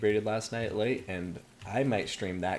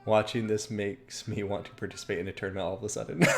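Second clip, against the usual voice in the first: ordinary talk, nothing marked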